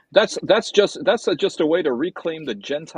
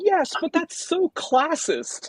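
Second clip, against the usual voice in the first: much higher, slow, prissy reproach; mock prim scolding; 14 semitones higher, slower than usual. Scolding Nancy about Cockneys, mock prim. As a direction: play the offended prude, high.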